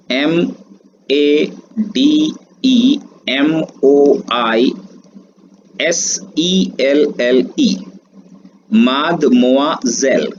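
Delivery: each letter spaced out, then the word; slow spelling. Shown as a demonstration. Teacher slowly spells the word for students. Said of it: "pronunciation इसका जो है".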